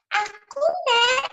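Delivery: put-on very high little-child voice; grandchild character. Shown as a demonstration. Grandchild answers grandma on stage, high child voice. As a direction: squeak it out as a small grandchild. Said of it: "kiri, ganti suara".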